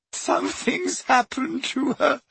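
put-on high, tearful girl's voice; sobbing. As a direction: choke on the words. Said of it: "sobbed Leanne".

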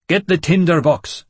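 urgent shout. A narrator's reading. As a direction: yell it out. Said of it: "I shouted".

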